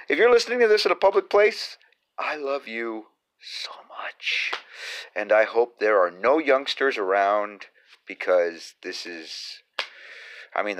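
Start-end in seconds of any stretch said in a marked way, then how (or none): 2.2-4.5 slow, breathy whisper. Acted. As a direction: whisper it close to the mic. Whispered aside to listeners.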